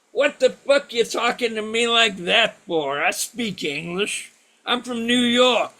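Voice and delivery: loud retold protest, hammed up; indignant cab driver. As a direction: act out an affronted New Yorker.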